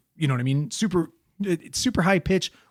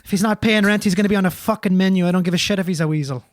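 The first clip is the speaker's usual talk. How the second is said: higher, blustering Irishman; Irish accent; 3 semitones higher than usual. Acted. Irish dad threatening a mongoose over rent, blustering Irish accent. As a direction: threaten in a comic Irish brogue.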